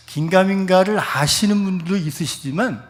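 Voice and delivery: ordinary talk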